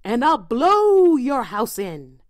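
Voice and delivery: slow, loud wolf threat building to a roar. A narrator's reading. Wolf threatening the pig in the straw house, slow building roar. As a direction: draw it out slowly and swell to a roar. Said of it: "roared the wolf".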